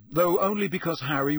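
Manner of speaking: ordinary delivery, nothing marked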